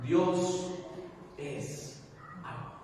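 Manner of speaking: "Dios", "es" and "amor" each set apart by long pauses; preacher echoes the answer "Dios es amor" word by word; slow, spaced words. Shown as a demonstration. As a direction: weigh out "Dios es amor" word by word, pausing between. Preacher repeats the congregation's answer.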